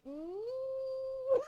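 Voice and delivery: squeaky held-back hum; a high awkward mm, fighting a laugh; strained high hum; high tight hum. Real, not acted. Speaker's reaction to the roasting.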